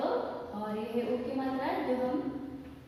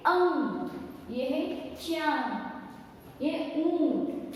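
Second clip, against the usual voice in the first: slow drawn-out reading; 3 semitones higher, much slower than usual. Shown as a demonstration. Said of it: "हम कैसे बोलेंगे इसे?".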